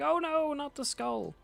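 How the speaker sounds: high, squeaky mock "oh no"; hammed-up dismay. Reading the comic, voicing the villain's horror at the skull, hammed-up high dismay. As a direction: wail it like a cartoon villain losing his prize.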